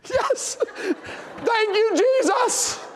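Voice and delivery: high, drawn-out joyful wail; hammed-up crying in grateful relief; mock tears of joy. Seven-year-old spared a spanking, mock tears of joy. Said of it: "she's, like, crying".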